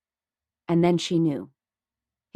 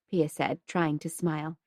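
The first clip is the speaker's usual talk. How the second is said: ordinary delivery, nothing marked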